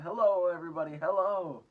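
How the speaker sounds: put-on boy's loud, high, joyful call. A narrator's reading. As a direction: call out gladly to the crowd below. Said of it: "laughing and waving and calling out".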